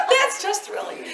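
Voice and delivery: high laughing delight. Real, not acted. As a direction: laugh through the words. Laughing after the impression.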